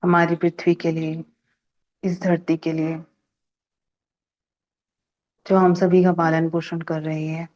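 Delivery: unhurried phrases with long silences between; guided meditation. Real, not acted. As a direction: lead a calm gratitude meditation, pausing after each phrase. Leads a gratitude meditation. (ठहर-ठहर कर शांत ध्यान निर्देश)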